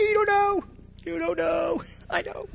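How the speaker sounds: high mock-childish taunt; taunting singsong. Kid teasing passersby, high taunting voice. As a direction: tease them like a kid on the playground.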